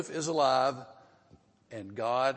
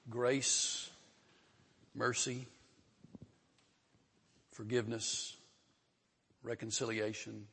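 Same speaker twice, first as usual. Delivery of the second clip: prayer; 2 semitones lower, 4 dB quieter than usual. Real, not acted. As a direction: pray quietly, phrase by phrase. Let's pray.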